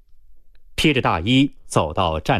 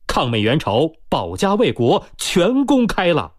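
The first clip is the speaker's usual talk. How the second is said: high-pitched, mildly alarmed call; worried shout; 7 semitones higher than usual. A narrator's reading. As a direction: cry out the news with some worry. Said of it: "有些担忧地大叫". (有些担忧地高声喊)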